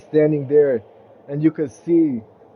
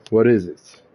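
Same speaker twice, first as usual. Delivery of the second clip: a lower, weary question; the king answers Todd wearily, lower; 6 semitones lower than usual. Acted. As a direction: ask it like you're tired of him already. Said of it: "looks at him very wearily and says".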